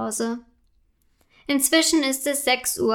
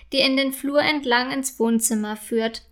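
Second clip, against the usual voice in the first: usual speaking voice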